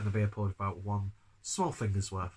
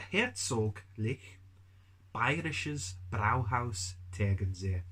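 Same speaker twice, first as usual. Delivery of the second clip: slow, hammed-up German accent on Herzoglich; much slower than usual. Shown as a demonstration. Beer reviewer names the brewery in a mock German accent. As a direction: drag Herzoglich out slowly in a thick mock German accent, rising at the end. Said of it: "You have to say it in a stereotypical German accent".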